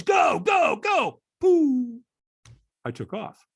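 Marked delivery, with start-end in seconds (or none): 0.0-1.2 loud, high acted shouts; urgent coaching yell; trainer urging on. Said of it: "And the trainer goes". 1.4-2.0 high, loud launch noise; voiced "pooh" of shooting off; explosive takeoff sound effect; imitated whoosh.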